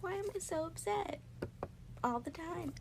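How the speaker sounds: high, soft mock despair; despairing self-question. Retelling asking herself why she is upset, high and soft. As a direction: ask yourself in quiet despair. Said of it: "And I was like".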